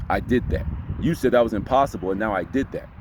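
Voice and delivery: low, grounded claim; low confident voice. Shown as a demonstration. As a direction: own it, low and sure. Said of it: "You see what I'm saying?".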